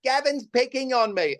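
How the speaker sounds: high-pitched mock complaint; tattling kids. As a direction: imitate a child telling on a cousin. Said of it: "And they're going".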